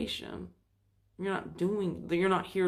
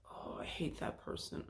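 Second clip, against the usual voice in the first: whispered grumble; 12 dB quieter than usual. Retold or imitated. Quoting the wandering mind.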